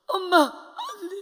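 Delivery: slow, loud, tearful cry of no; anguished cry. Retold or imitated. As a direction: cry out in despair. Child realizing mother has gone. (절망하며 울부짖듯)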